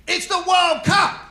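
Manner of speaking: high, loud, drawn-out shout; dad shouting. Retold or imitated. Father bellowing at his son over the phone call. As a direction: bellow it like your match is ruined.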